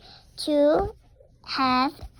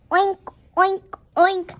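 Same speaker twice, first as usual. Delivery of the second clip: louder higher playful oinking; pig oinks; 4 semitones higher, 6 dB louder, faster than usual. Pretend. Child pretending to be a pig, loud oinking. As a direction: oink like a pig, loud and happy.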